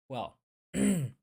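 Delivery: ordinary talk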